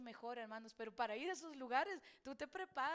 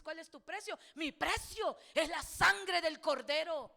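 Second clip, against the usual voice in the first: full-force preaching; 9 dB louder than usual. Acted. Predicación a plena fuerza. (predicación a todo pulmón, lenta y fuerte)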